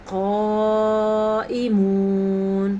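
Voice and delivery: قائمون with a heavy qaf and long madd; six-count madd. Shown as a demonstration. Teacher modeling the six-count madd. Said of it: "موٹا قاف ہے".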